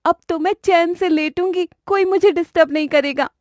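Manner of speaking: put-on high, soft, relieved young bride; lazy bride settling in to sleep; young bride Reema. (ऊँची, धीमी, चैन भरी बहू की आवाज़)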